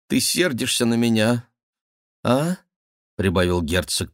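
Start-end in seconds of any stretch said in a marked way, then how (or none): none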